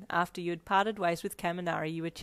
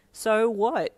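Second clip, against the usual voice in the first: louder, higher playful feigned ignorance; teasing; 4 semitones higher, 9 dB louder than usual. A narrator's reading. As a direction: tease by playing innocent. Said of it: "you teased".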